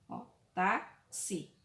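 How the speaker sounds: táxi said slowly, split into its two syllables tá-xi; teacher slowly splitting táxi into syllables for the class; syllables drawn out. Shown as a demonstration. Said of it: "duas sílabas aqui".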